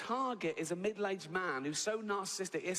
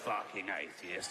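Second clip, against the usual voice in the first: bored devil growl; 8 semitones lower than usual. Retold or imitated. The devil grumbling at the atheist, low and bored.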